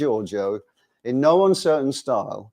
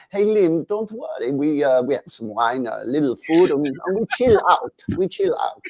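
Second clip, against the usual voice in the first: put-on Italian accent; 3 semitones higher than usual. Giorgio telling the singer not to worry, mock Italian accent. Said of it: "he's Italian".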